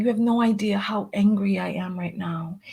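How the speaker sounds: usual speaking voice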